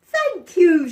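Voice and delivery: a glad yelp of thanks; dog's thanks. Acted.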